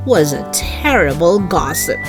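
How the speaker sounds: ordinary delivery, nothing marked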